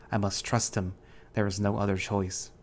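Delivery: ordinary delivery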